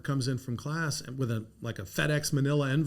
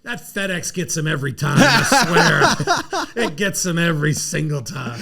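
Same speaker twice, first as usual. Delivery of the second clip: laughing gloat; 3 semitones higher, 8 dB louder than usual. Retold or imitated. Coach gloating about his FedEx trick, laughing. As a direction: laugh through the boast. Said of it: "Skip goes".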